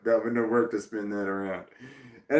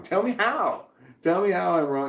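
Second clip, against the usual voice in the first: exasperated demand; 7 semitones higher than usual. Real, not acted. Ranting at people who criticize.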